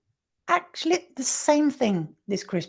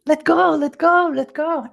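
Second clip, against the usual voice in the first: imitating a panicked child's yell, higher and louder; mock child shout of let go, let go; retold kid's scream, high and loud; 6 semitones higher, 6 dB louder than usual. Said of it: "my kid was screaming like".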